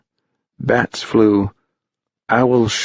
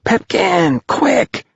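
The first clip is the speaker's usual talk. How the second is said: put-on high, urgent boys' yell, held in volume; 12 semitones higher, much slower than usual. A narrator's reading. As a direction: yell high to hurry a friend along. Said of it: "they all yelled".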